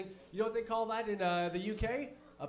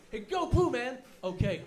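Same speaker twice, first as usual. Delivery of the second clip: mock angry exchange; 5 semitones higher, 5 dB louder than usual. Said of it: "he was angry".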